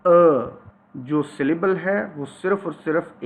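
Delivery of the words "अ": अ held as a long, loud schwa; long neutral vowel अ, demonstrated loudly. Shown as a demonstration. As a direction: draw out अ with no r. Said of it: "इसकी pronunciation जो है long श्वा".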